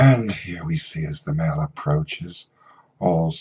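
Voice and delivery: slow, hushed nature documentary narration; low wildlife narrator voice; David Attenborough. Retold or imitated. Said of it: "David Attenborough".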